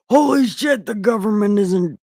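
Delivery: Adam yelling his sudden realisation; mock shocked shout. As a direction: blurt it out in a hammed-up yell.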